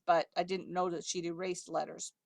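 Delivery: ordinary talk, nothing marked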